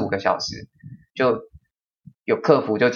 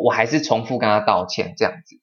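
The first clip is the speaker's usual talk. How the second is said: normal talking voice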